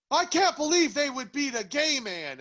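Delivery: high, slow mocking whine; mocking whiny quote. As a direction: parody his self-pity, drawn out. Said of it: "him being the conservative that he is goes".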